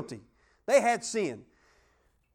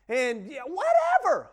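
fed-up shout; 5 semitones higher, 4 dB louder, slower than usual. Pretend. Fed-up exclamation about house.

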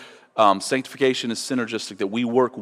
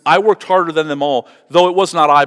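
"worked" punched louder and higher; louder emphatic quote; 3 semitones higher, 7 dB louder than usual. Shown as a demonstration. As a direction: proclaim Paul's words with force. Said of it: "He says".

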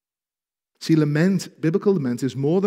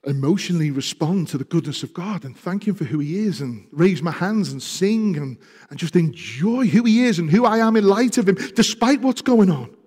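louder, higher rising fervour; preaching at full force; 6 semitones higher, 8 dB louder than usual. Acted. Preacher building up a joyful picture of worship, louder and louder. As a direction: build it up with joy.

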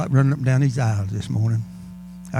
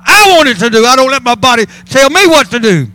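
loud, raised revival preaching; full-force preaching; 7 semitones higher, 6 dB louder than usual. Real, not acted. Preacher telling church to start shouting, full-force preaching. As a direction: urge them on with high, forceful cries.

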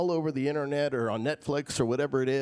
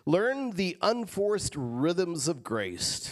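slow savoring read; 4 semitones higher, much slower than usual. Acted. Savoring verse slowly.

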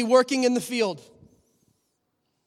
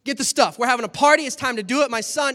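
loud, high, joyful orders; excited father; 5 semitones higher, 7 dB louder, faster than usual. Acted. Father brushing off his son's confession, excited. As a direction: throw the party now.

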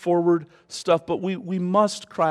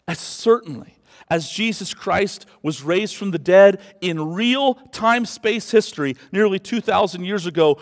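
usual speaking voice

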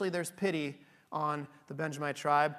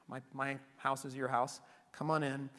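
hushed kindly host; 5 semitones lower, 10 dB quieter than usual. Retold or imitated. Old host welcomes the travellers.

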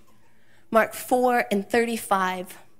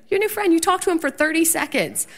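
much higher, amused disbelief; incredulous mock reply; 7 semitones higher, faster than usual. Wife teasing husband about his new friend, incredulous. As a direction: toss it back in mock disbelief.

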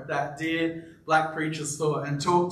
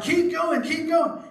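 high, raised cries of "keep going"; mock yell; 11 semitones higher, slower than usual. Pastor imitating men calling out in church, high mock yell. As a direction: urge him on like a man in the pews. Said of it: "men were yelling".

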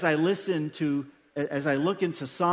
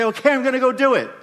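fast, higher and louder, hurried yes; eager mock obedience; 5 semitones higher, 6 dB louder, faster than usual. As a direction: blurt it out like a startled believer. Congregation struck down, obeying.

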